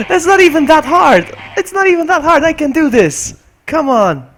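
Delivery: high, loud wail broken by laughs; exasperated shouting. Real, not acted. Streamer fails an easy level, laughing exasperated shouts. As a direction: protest loudly through laughter.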